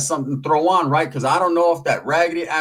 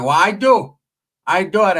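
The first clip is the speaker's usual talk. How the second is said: louder, higher mock retort; imitated indignant aunt; 4 semitones higher, 4 dB louder than usual. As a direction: snap back like an offended aunt. Aunt retorting in retold quarrel.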